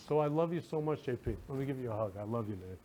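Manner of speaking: tender hug. Real, not acted. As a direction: murmur it while hugging him.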